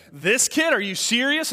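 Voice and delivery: incredulous scoff. Acted. MMA fighter sizing up a scrawny kid, incredulous. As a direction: scoff at a hopeless challenger.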